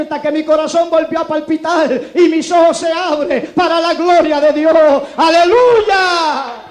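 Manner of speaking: slow, shouted, high hallelujah; preaching climax. Acted. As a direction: cry out the praise at full force. Clímax de predicación. (clímax de predicación, gritado y lento)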